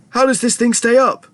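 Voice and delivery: high, incredulous question; high-pitched anxious bewilderment; alarmed cry. A narrator's reading. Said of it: "cried Grandpa Joe".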